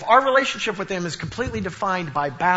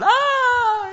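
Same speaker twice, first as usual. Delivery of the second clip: high mock wail; imitated bawling; 5 dB louder than usual. Dad imitating daughter bursting into tears. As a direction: let out a toddler's crying wail. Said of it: "she just starts bawling".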